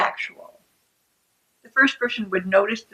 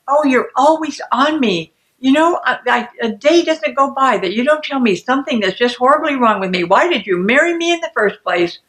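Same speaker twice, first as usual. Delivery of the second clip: higher, louder wounded complaint; exasperated arguing; 4 semitones higher, 5 dB louder than usual. Acted. Second person hits back in a circular argument, exasperated. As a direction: fire back as the fed-up spouse. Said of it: "The circular argument is getting underway".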